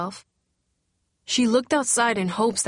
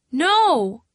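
loud, high cry of denial; shouted refusal at the news; anguished shout; 7 semitones higher, 6 dB louder than usual. A narrator's reading. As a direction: scream it out in disbelief. News of eviction lands.

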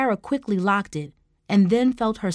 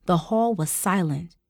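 ordinary delivery, nothing marked

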